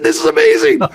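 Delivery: high, delighted, laughing through it. Retold or imitated. Boss laughing at the catfish chaos, delighted. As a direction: squeal with glee. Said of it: "I start laughing".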